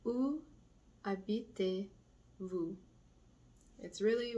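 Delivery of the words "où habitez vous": Où lifted, habitez-vous slow; slow split question. Shown as a demonstration. Teacher slowing the formal question for students. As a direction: break it apart slowly.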